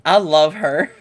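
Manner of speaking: gushing. Real, not acted. Player gushing after laugh.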